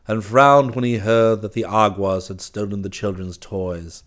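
usual speaking voice

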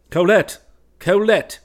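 loud, high call through the door. Acted. West calls Colette through the barred door, loud. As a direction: call her name across the cellar. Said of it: "he called".

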